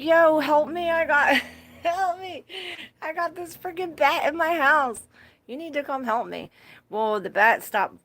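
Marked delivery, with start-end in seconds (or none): 0.0-6.5 high, loud begging call for help; retold panicked plea. Calling her friend about the bat, panicked and high. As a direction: beg a friend to come now. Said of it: "I'm like".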